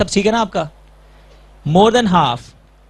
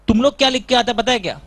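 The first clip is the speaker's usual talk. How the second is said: ordinary talk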